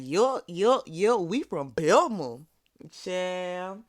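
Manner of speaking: drawn-out, punchy retold retort; sassy mock retort. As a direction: imitate a proud hometown clapback. Acting out Miss Wanda's retort.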